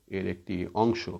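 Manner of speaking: ordinary talk, nothing marked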